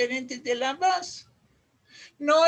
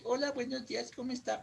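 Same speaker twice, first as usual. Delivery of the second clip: too quiet; 9 dB quieter, faster than usual. Acted. Imitating someone asked to speak up, still too quiet. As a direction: still soft, unprojected greeting. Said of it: "Más fuerte".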